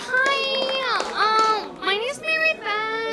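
put-on very high-pitched girly stand-up voice; first-time comic in a high, put-on voice; gushing amateur comedian.

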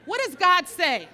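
loud firm Puerto Rican mother, no nonsense; Puerto Rican mom. Pretend. Holy Spirit as Puerto Rican mom, loud and firm. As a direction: bark it like a mom who does not play. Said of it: "if the Holy Spirit was a Puerto Rican mom".

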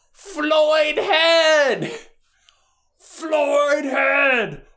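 high, loud drawn-out fan holler; woman yelling. Retold or imitated. Woman proclaiming herself a Floyd Head, imitated high yell. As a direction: holler it like a newly converted fan. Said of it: "the woman goes".

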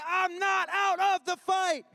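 fierce, determined preaching shout; defiant shout. Acted. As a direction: roar it like a boxer getting up. Defiant quote at sermon climax.

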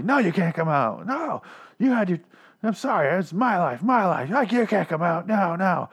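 high-pitched, frantic mock scream; imitated screaming. Host imitating a man screaming at his mirror self, mock screaming. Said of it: "He's screaming at himself".

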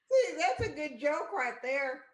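high laughing speech. Real, not acted. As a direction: crack up while talking. Laughs hard at joke.

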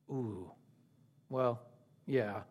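very low, soft, halting admission; sheepish concession. Acted. Grumblers reminded of the agreed wage, acted sheepishness. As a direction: back down quietly, caught out.

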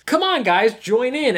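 mock cheerful come-on to the viewers; excited invitation. Kid looks into the camera and invites everyone, hammed-up cheer. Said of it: "goes right to the camera and says".

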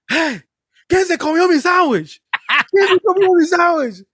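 high, loud furious dad shouting in Spanish; retold angry yell. Dad yelling about his stolen sandwich, loud and furious. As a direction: yell at the kids like an angry father. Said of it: "He starts yelling and shit".

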